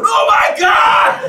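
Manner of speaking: high, drawn-out yell; hammed-up scream of alarm; mock agonized shout. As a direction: cry out like a deafened engineer. Engineers' cry at deafening headphones.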